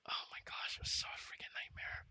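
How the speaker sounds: hushed, breathy whisper of dread; whispering about the porta potty nightmare. Real, not acted. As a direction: whisper it like a horror memory. Remembering the latrine horror.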